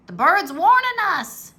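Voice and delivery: high, loud alarmed yell; pirate shouts about the bird; frightened shout. A narrator's reading. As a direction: shout the warning. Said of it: "shouted Pinky".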